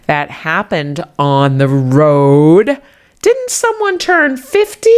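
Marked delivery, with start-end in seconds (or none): 3.2-4.9 very high squeaky put-on voice; teasing other voice. Pretend listener reminding her of her birthday, high squeaky tease. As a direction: pipe up like a nosy sidekick.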